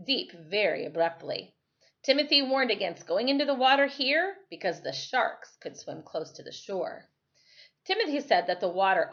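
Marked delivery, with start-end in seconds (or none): none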